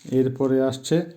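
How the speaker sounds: usual speaking voice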